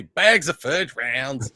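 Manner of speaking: put-on slow, drawn-out Southern accent. Mimicking a Southern singer, slow Southern accent. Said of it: "to hear a Southern accent say".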